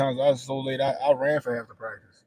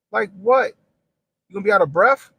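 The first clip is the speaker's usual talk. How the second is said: louder, scoffing at the excuse; loud mocking question; 7 semitones higher, 10 dB louder than usual. Real, not acted. Father mocking daughter's running excuse, loud scoff. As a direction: pitch it up and sneer loudly.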